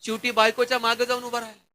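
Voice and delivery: usual speaking voice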